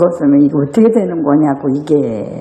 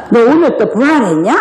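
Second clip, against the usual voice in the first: mock taunt; 4 semitones higher than usual. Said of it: "막 이러면서".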